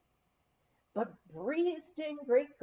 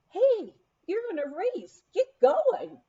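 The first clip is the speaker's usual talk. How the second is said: higher, louder call across the slope; yell; 9 semitones higher, 7 dB louder than usual. A narrator's reading. Official yelling at the stalled racer, loud and high. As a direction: shout at her to get moving. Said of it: "heard someone yell".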